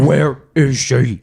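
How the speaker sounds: slow, strained mock growl; mock Bale Batman growl. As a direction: ham up the Bale Batman rasp, slowly. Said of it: "It was, like, terrible".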